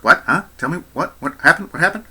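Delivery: quick rising questions, imitated; climbing, insistent acted questioning; eager interjections. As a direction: butt in with mock-urgent little questions. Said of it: "doing the little interjection".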